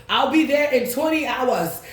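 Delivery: high, slow and laughing; mock relative. Imagining the family in Africa answering, high and laughing. Relatives can't just fly over.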